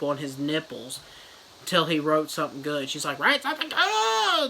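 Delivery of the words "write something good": high, put-on shrieking nurse demand; mock demand. Nurse yelling at the writer to do better. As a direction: screech it at the captive writer.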